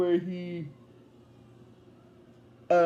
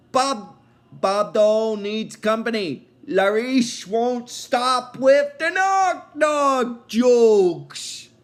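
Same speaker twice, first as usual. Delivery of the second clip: loud character voice; 4 semitones higher, 5 dB louder, much slower than usual. Retold or imitated. Bob Dole head complaining loudly.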